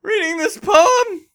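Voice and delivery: high, pleading whine; insecure poet. Retold or imitated. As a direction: beg desperately, voice cracking. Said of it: "he was like".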